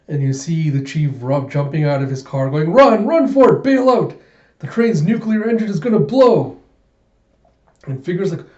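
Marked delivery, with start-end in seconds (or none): 2.7-6.6 high, loud, acted alarm; panicked warning shout. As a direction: yell for everyone to bail out. Chief yells warning as he flees.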